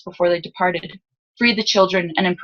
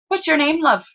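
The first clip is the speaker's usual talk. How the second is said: higher-pitched, friendly lower-class Salzen lad; lower-class Salzen accent; 9 semitones higher than usual. A narrator's reading. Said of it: "belonged to the lower class of Salzen".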